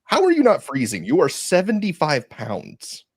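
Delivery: high, loud disbelief; half-shouted amazement, slowing down; incredulous. Retold or imitated. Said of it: "I'm just like".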